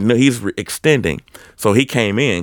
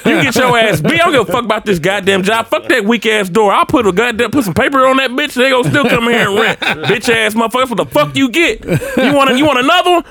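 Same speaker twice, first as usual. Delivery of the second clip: high-pitched hyped-up tirade; fired-up taunting; 11 semitones higher than usual. Retold or imitated. Clerk daring the renter to fight, fired up. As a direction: re-enact a combative rant, voice raised high. Acting out his fight challenge.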